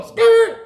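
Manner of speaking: loud, very high squealing skirt; tire screech. Retold or imitated. Taking off from the ATM, loud high tire screech. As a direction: screech off like peeling tires.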